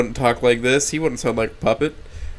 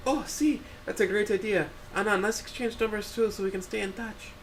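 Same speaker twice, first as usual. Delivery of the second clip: bright higher voice, soft and eager; cheerful Rosa; 10 semitones higher, 9 dB quieter than usual. Acted. Rosa asks Anna to stay in touch, higher and soft. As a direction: Rosa suggests swapping numbers with Anna, lilting. Rosa's voice in the story.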